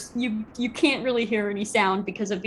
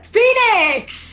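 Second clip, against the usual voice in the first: very high, loud, drawn-out name; full-blown ring announcer holler; announcer's shout; 13 semitones higher, 8 dB louder than usual. Acted. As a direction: belt the name to the whole arena.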